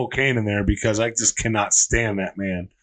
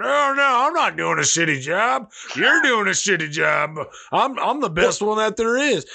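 high, smug mock boast, pitched up; a mocking impression of Jerry Jones whining back; 6 semitones higher than usual. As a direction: ham up a defensive owner brushing off blame. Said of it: "all I ever hear out of Jerry Jones".

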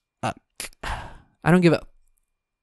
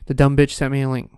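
ordinary delivery

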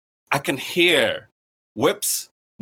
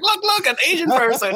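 excited mock announcement; 12 semitones higher, 3 dB louder than usual. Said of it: "It's like".

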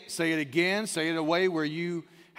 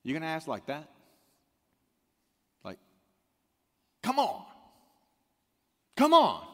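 gentle rebuke; 3 semitones lower than usual. Retold or imitated. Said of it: "And Jesus is like".

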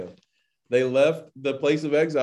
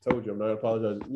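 rushed, softer grumble; fast mock mutter; 6 semitones lower, 5 dB quieter, much faster than usual. Teacher joking to viewers as the stubborn havruta, fast mutter. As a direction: throw it away fast and low. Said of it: "being a little crazy".

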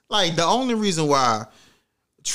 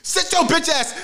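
high-pitched mock yell of mama; much higher, fierce mimicked scolding; mother in terror mode; 13 semitones higher, 3 dB louder than usual. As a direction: imitate a mother screaming at her kid. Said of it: "my mama go from terror".

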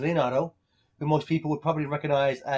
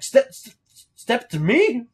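stammered, higher and louder; stuttering threat; 8 semitones higher, 7 dB louder, slower than usual. Retold or imitated. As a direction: stumble over step. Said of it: "she's like".

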